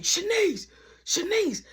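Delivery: high, urgent call for someone; mimicked high-pitched summons. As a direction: call the name out to another room. Calling for help from his room.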